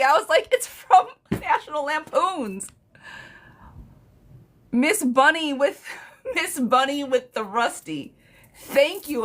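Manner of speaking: high laughing delight. Real, not acted. Reader laughing with the one viewer who got it. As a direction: giggle through the words. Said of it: "I'm dying over here laughing".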